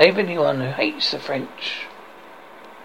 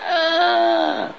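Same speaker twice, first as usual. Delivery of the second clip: high, held "aah"; mock wail while being flung away; falling scream; 12 semitones higher than usual. Bridge keeper thrown off.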